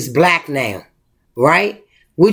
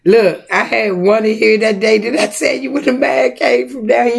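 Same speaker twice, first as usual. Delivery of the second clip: normal talking voice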